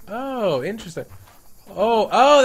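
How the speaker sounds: ordinary talk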